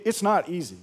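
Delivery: ordinary talk, nothing marked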